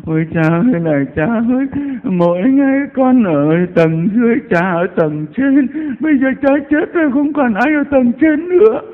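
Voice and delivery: loud, high-pitched keening, imitating a bereaved cook; mock wailing. Parish cook mourning her dead priest, mock wailing. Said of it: "đến khi cha xứ chết rồi bà khóc".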